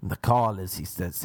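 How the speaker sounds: normal talking voice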